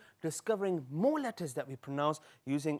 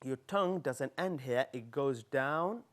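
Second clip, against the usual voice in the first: ordinary delivery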